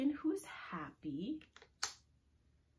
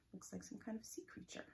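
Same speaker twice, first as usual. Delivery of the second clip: whispered aside; 4 semitones higher, 7 dB quieter than usual. Real, not acted. Aside to kids.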